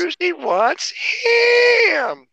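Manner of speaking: put-on slow, high childlike Ponyo voice. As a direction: stretch each word out like a toddler. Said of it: "reached Ponyo status where he likes ham".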